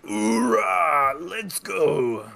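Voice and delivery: loud, high army cheer; rally the troops with a shout; loud gung-ho soldier. Pretend.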